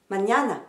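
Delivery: Mañana with the weight on "ña"; Mañana, middle syllable lifted, then falling; stress on second syllable. Shown as a demonstration. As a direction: say Mañana in Spanish, ma-ÑA-na. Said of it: "l'accent sera toujours sur la deuxième syllabe".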